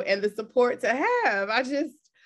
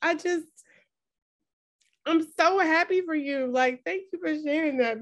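high, voice welling up with feeling; teary and moved; 6 semitones higher than usual. Real, not acted. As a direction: push through happy tears. Moved, thanking the host.

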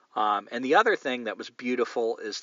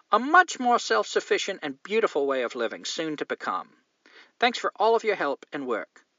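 reading a fan letter in a put-on English accent, a bit higher; mock British, chirpy English letter voice; 7 semitones higher than usual. Host reading a listener's letter in English accent. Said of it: "let's see if I can do an English accent".

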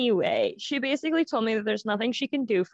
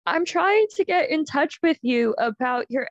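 higher, louder imitated caller; doctor's voicemail; 6 semitones higher, 5 dB louder than usual. Doctor leaving a voicemail for her patient, imitated caller voice. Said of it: "leaves a voicemail that goes".

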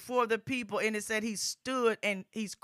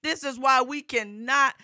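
rising, louder gospel preaching, driving each phrase; full-force preaching; 5 semitones higher, 7 dB louder than usual. Acted. Preacher urging the congregation, full-force preaching. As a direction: preach it out to the whole congregation, building.

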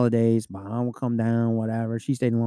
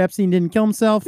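cop voice; 8 semitones higher, 3 dB louder than usual. Pretend. Cop repeats it higher.

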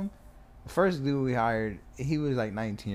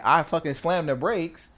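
normal talking voice

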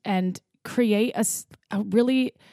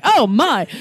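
loud, higher mock-startled exclamation; mock scared; 4 semitones higher, 7 dB louder than usual. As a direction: imitate a scared man's shocked outburst. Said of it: "he was scared".

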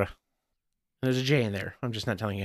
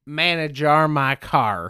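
slow Southern drawl; hammed-up Southern accent; 2 semitones higher, 4 dB louder, slower than usual. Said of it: "go back to the southern accent".

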